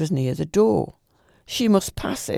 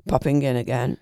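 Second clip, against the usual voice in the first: plain reading voice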